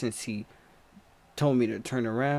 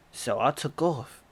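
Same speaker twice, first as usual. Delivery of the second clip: usual speaking voice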